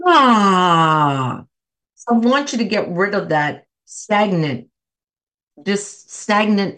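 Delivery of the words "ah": big audible sigh, louder. Shown as a demonstration. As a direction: release it all in one long loud sigh. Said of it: "you're gonna sigh it out like".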